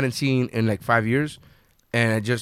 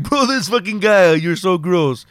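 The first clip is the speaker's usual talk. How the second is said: put-on slow higher disgusted drunk voice; 5 semitones higher, 3 dB louder, slower than usual. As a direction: slur your disgust. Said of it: "I was like".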